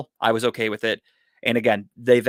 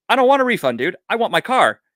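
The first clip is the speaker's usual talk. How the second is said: much higher and louder, a retold incredulous yell; exasperated outburst; 11 semitones higher, 5 dB louder than usual. To the valet guy offering a refund, a loud exasperated outburst. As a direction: blurt it out, fed up and disbelieving.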